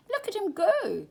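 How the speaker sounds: high loud squawk; squawking crow. A narrator's reading. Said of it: "squawked the crow with glasses".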